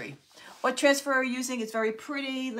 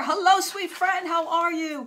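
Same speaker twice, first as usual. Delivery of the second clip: high, loud and slow, gushing hello; gushing hello to a friend joining the stream; delighted greeting; 9 semitones higher, 7 dB louder than usual. Real, not acted. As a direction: greet her like you're overjoyed to see her.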